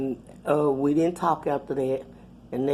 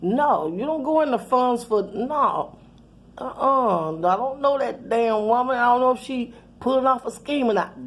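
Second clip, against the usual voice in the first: laughing, a lightly voiced son scolding higher; half-laughing mock scolding as her son; son fussing; 7 semitones higher than usual. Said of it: "And he was like".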